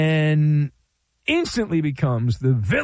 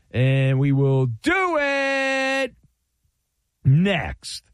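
drawn-out tease; 3 semitones higher, much slower than usual. Acted. Host teasing listeners before the break, slow and stretched. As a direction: drag it out to tease the answer. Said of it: "teasing the answer".

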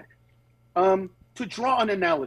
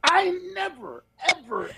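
ordinary talk

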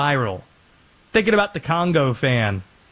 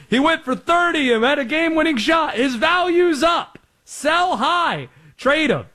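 high-pitched, urgent mock excitement; excited retold reaction; 10 semitones higher than usual. Host retelling his reaction to a game-winning shot, excited. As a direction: pitch it up high, selling a hot take.

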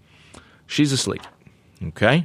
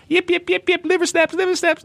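much higher yapping dog voice; yipping poodle wanting treats. Pretend. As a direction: yap liver snaps like a tiny dog.

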